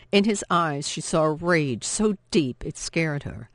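plain reading voice

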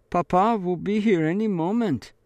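put-on a scared girl bluffing; frightened bluff. A narrator's reading. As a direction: pretend confidence over fear.

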